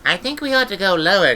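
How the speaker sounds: put-on high urgent child's voice; urgent girl. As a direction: press her worriedly. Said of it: "she said urgently".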